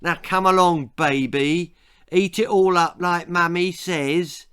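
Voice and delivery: mock him like a fussing mum; bully sneering in a mock-mummy voice; sneering mock-mother. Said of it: "sneered Johnny".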